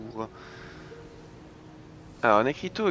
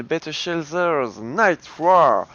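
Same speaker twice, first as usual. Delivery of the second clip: English accent; 3 semitones higher, 7 dB louder, much slower than usual. Real, not acted. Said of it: "vive mon accent anglais".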